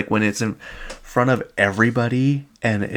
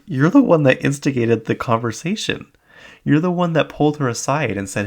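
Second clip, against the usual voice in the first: louder, higher accusing comeback; indignant retort; 6 semitones higher, 4 dB louder than usual. Retold or imitated. Viewer answering the star who blames others, indignant. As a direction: call her out, pitched up in disbelief. Arguing back at Whitney's complaint.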